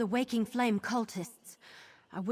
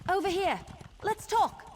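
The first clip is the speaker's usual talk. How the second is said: raised voice, pitched high, calling someone over; 8 semitones higher than usual. Acted. Companion calls the player over, raised calling voice. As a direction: call across to get their attention.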